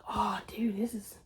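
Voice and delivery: soft, breathy hush of dread; scared whisper. Retold or imitated. Alone at the hose, sensing something watching, a scared whisper. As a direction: whisper it like something might hear.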